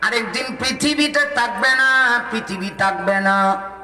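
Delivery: chanted sermon with drawn-out line endings; slow sing-song Bengali preaching; melodic waz preaching. Real, not acted. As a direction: intone it slowly to the congregation, stretching the last syllables.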